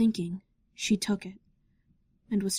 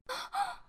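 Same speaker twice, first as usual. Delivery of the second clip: sharp gasp; 10 dB quieter than usual. Acted. Princess sees smudged tear-stained letter.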